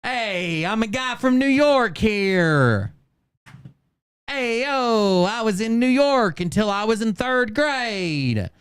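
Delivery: high, slow, hammed-up New York accent. Host mocking his claim to be a New Yorker, exaggerated New York accent. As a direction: drag out a cartoonish New Yorker voice.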